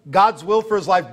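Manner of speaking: usual speaking voice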